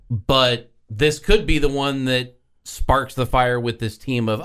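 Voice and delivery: ordinary talk, nothing marked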